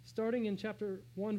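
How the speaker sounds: normal talking voice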